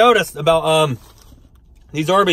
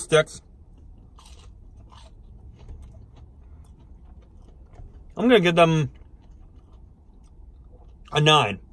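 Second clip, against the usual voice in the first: speaking between bites; talking while chewing. Real, not acted. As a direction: mouth partly full of food. Seen 0: Bites and chews fried cheese stick between phrases throughout the labelled words.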